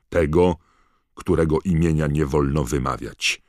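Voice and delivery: loud, stern reading of charges; forceful prosecutor's declamation. A narrator's reading. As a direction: hammer out the accusation over any protest. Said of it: "podniósł głos, żeby zagłuszyć swego syna".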